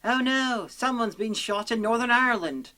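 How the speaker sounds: high, loud sarcastic alarm; mock shock. Reviewer mocking the guys hearing a gunshot, sarcastic shock. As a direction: exclaim it in hammed-up horror.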